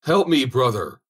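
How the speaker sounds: loud, high, slow plea for help; drawn-out desperate cry. A narrator's reading. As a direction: call out as the water reaches your mouth. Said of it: "cried the soldier".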